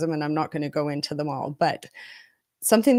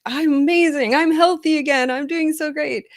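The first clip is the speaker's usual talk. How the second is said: higher, louder burst of feeling great; elated self-quote; 9 semitones higher, 4 dB louder than usual. Retold or imitated. Telling how good spells felt after illness, elated. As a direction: replay the thrill of being well. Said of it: "just feel like".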